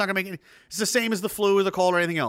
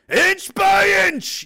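booming, slow Pacino-style shout; Pacino speech; 13 semitones higher, 7 dB louder, much slower than usual. Retold or imitated. Quoting Pacino's locker room speech, roaring slowly. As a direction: bellow it like a coach's halftime speech. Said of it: "play that Pacino speech again".

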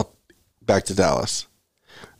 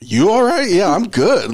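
high, light imitation of himself; mock own reply; 8 semitones higher, slower than usual. Retelling his answer to the girl, high and light. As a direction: play it high and breezy. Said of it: "And I'm like".

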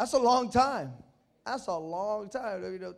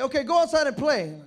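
put-on loud, raised mom shooing kids out; mom's order; 6 semitones higher, 10 dB louder than usual. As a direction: call it out like a mother. Mom sending kid out.

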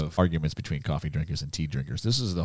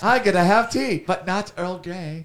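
hammed-up British accent, pitched higher; 9 semitones higher than usual. Host doing a British voice for the tea talk, mock British accent. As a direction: play a cheery English gent, British accent. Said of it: "we need a British accent".

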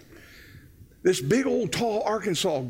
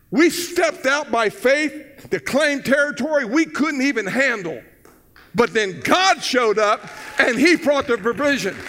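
raised, loud, emphatic charge; full-force preaching; 7 semitones higher, 5 dB louder than usual. Real, not acted. Pastor challenging church to step out in faith, rousing preaching. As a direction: build it into a rousing pulpit charge.

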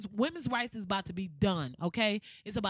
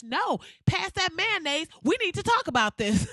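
mock rallying call; 7 semitones higher, 6 dB louder than usual. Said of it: "real ass conversation like".

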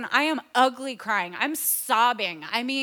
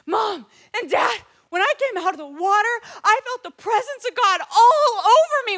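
high, loud, breaking the news to his parents; excited son; 7 semitones higher, 8 dB louder than usual. Retold or imitated. Son phones parents after his baptism, excitedly. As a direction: gush like an amazed kid.

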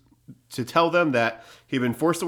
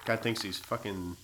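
muttering; 3 semitones lower, 13 dB quieter than usual. Real, not acted. Muttering insult at partner's ad.

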